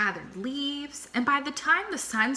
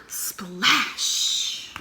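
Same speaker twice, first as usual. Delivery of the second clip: whispered splash. A narrator's reading. Sound effect.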